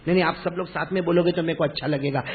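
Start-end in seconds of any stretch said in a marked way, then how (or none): none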